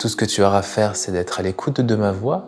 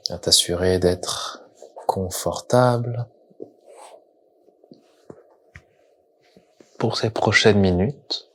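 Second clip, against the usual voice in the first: guided meditation. Acted. Leads a guided yoga nidra. (voix de méditation guidée, lente et posée)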